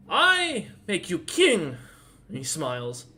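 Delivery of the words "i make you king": put-on small child voice, higher, slow and earnest; a tiny kid solemnly crowning you; little Daka. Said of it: "little Daka just looks up at you and goes".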